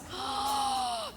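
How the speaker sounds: very high startled gasp; mock shocked gasp. Real, not acted. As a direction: gasp as if scandalized. Reaction at singles bar.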